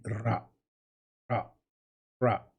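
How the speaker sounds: "ra" as a separate, clearly articulated syllable; Arabic R demo. Shown as a demonstration. Teacher showing students where the tongue goes, Arabic R demo. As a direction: say "ra" with the tongue placed for a rolled R. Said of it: "mets ta langue ici et sors".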